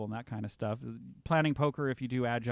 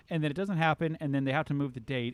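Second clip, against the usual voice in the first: normal talking voice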